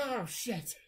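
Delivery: high-pitched comic alarm; mock panic. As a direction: play startled panic for a laugh. Acting trapped cabin occupants' panic.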